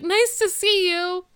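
high, slow, embarrassed; high awkward greeting. Retold or imitated. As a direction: squeak it out, caught off guard. Embarrassed wife greets guest.

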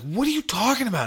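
high, baffled question; bewildered. Retold or imitated. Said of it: "I was like".

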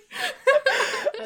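loud squealing laugh; high shrieking laugh. Real, not acted. Laughs at joke.

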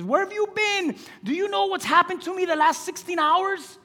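high-pitched exasperated complaint; healed man complaining. Acted. Healed man venting to Jesus, high and exasperated. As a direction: pour it out to Jesus, high and worked up.

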